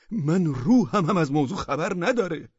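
loud, high indignant outburst; angry. Acted. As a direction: protest your innocence, furious and loud. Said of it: "عارف بیگ با عصبانیت گفت".

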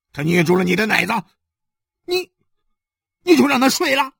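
high, loud outburst; furious interrogation. Acted. Husband demands details from his wife, raging. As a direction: build to an outraged shouted question.

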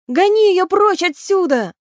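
high, urgent command; exclamation. A narrator's reading. Kelly ordering the river horse to chase banshee, urgent cry. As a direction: drive them on with a high shout. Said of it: "воскликнул Келли".

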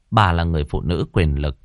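ordinary delivery

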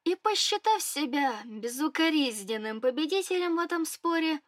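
usual speaking voice

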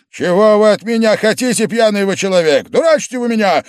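high, loud, furious outburst; frantic shouting. A narrator's reading. Host accusing his drunken guest, frantic shout. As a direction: shout in a frenzy of exasperation. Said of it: "неистово закричал Вельчининов".

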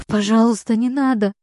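hoarse tearful high pleading; after crying. Acted. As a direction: beg through tears, voice hoarse from screaming. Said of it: "Осипшим от криков и заплаканным голосом умоляет".

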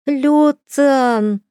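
put-on high, loud child's shout. A narrator's reading. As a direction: call out in a small boy's voice. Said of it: "прокричал он".

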